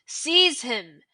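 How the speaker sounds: loud, commanding scream; villain's screamed command; screamed order. Acted. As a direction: scream the command at your servant. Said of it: "Voldemort screamed".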